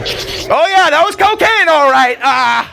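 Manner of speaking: very high, giddy sheepish report; mock coked-up undercover, high pitched; high stoned undercover. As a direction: play it like a guilty cop caught high. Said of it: "I'm just like".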